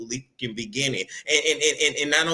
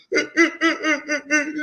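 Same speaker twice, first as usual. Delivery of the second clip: high mock crying laugh; hammed-up sob; 12 semitones higher, 3 dB louder than usual. Vlogger mimicking Tyrese's tears, hammed-up sob. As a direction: let out a theatrical sobbing gasp. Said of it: "That was a good fucking impression".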